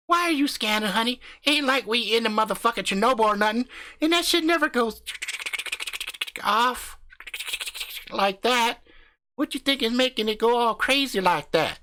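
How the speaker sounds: brash country husband hyping up his channel; cocky backwoods YouTuber swaggering through the intro; crude hillbilly husband. Acted. Husband questions radiation meter.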